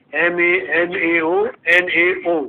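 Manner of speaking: each letter drawn out slowly; slow dictation. Shown as a demonstration. Teacher dictating the repeated form to the class, slowly. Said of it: "फिर नीचे लिखो".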